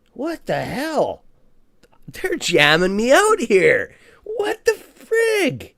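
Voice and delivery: slow, climbing to a high cry of disbelief; rising frustration. Real, not acted. Trader cries out as the app blocks his trade. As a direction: let it build from a groan to a wail.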